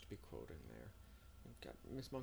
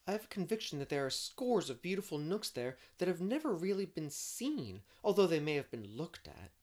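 higher, lively storybook heroine; eager, dreamy Anne; 8 semitones higher, 10 dB louder than usual. Acted. Anne planning a birthday outing in the spring, eager and dreamy. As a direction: voice an enthusiastic dreamer, pitched up.

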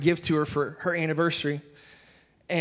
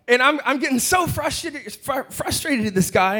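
worked-up retelling; frustrated; 9 semitones higher than usual. Retold or imitated. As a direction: pitch jumps high with mounting frustration. Telling of his frustration.

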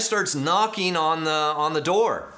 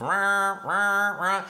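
higher mock alarm blare; imitated alarm; 5 semitones higher, much slower than usual. Preacher imitating the emergency alarm going off, mock blaring. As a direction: blare it out like a siren.

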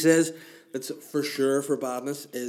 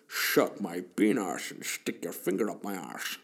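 Sean Connery impression; 3 semitones lower than usual. Bond talks to Miss Moneypenny in a Scottish accent. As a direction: ham up a Scottish Bond with every s as sh. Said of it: "Sean Connery, anything with an S".

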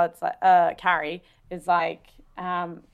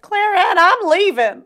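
much higher, louder call with a southern lilt; mild southern accent; 12 semitones higher, 8 dB louder, slower than usual. Retold or imitated. Friend imitating Samantha's goodbye in a southern accent. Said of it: "She has, like, a little southern accent".

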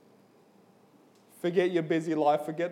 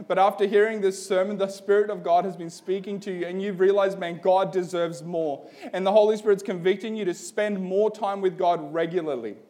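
altar call appeal; 2 semitones higher, 4 dB louder than usual. Real, not acted.

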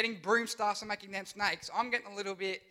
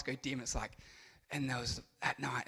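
hushed spooky; 8 semitones lower, 9 dB quieter than usual. Acted. Setting a creepy scene.

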